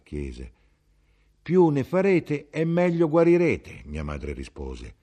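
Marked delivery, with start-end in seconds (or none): 1.4-3.8 mother reassuring. Acted.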